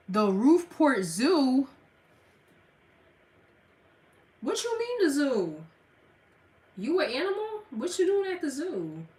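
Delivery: high, incredulous teasing; mock the answer playfully; teasing disbelief. Real, not acted. Streamer teases a viewer about being at the zoo, incredulous.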